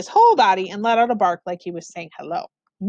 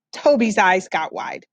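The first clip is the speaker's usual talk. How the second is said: plain reading voice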